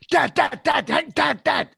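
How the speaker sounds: high, loud urgent calling of Dad; breathless kid shouting for his father; excited calling out. Retold or imitated. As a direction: call him over and over, all worked up.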